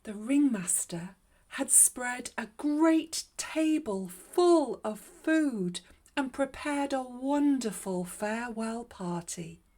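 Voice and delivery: ordinary delivery, nothing marked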